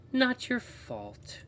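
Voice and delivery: very slow, high falsetto; eerie woman's voice, each word drawn out; woman's radio voice. Acted. Walkie voice absolves the narrator, slow high woman's voice. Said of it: "voice comes through a little clearer".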